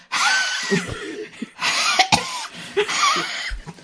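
high squealing laugh. Real, not acted. Embarrassed laugh on set.

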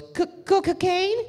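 high imitated stutter, rising into a question; stuttering mama-san. Mama-san stuttering out her offer, high questioning voice. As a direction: stammer the first syllable in a high put-on voice. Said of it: "she stutters".

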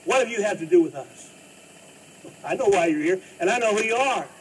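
possessed man shouting at Jesus in the synagogue; demon screaming out. Retold or imitated. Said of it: "he screams out with a loud voice".

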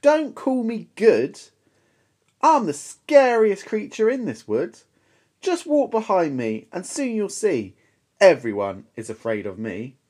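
high, loud swagger; bold boast. Acted. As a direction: puff yourself up. Mouse boasts to monster.

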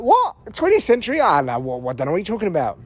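high, cheery mock German voice; breezy, dismissive Germans brushing off history. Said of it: "they were like".

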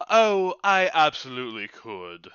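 put-on lightly posh Birmingham accent, grandly polite; a waiter putting on a regal Birmingham accent. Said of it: "regal Birmingham accent".

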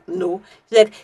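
ordinary delivery

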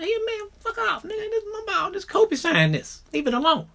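put-on guard your ball like a young kid. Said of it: "and I'm young".

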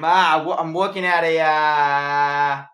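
mock Boston accent with drawn-out vowels; hammed-up Boston kid reporting to a parent. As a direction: stall mid-sentence in put-on Boston. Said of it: "That's not a good Boston accent".

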